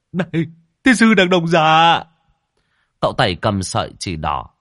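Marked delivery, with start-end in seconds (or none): 0.1-2.0 high, loud indignant shout; angry cursing. Acted. Shoved as a shield, he swears, angry shout. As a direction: curse him out, pitched way up. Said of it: "thì chửi".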